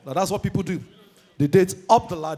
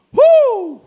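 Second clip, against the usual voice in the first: high, loud party whoop; excited woo; 9 dB louder than usual. Real, not acted. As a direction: whoop like you just walked into the party. Hype cry.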